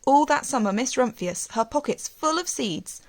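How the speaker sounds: ordinary delivery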